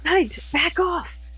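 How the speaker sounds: high, drawn-out warning shout. Acted. Star shouting at Knight to pull him back, high shout. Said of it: "Star shouts".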